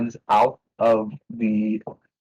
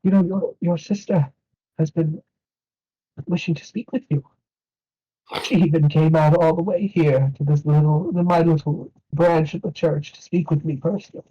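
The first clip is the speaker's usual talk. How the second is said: put-on high, kindly old priest; 7 semitones higher than usual. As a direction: tell him his sister wants to speak. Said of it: "priest about sister".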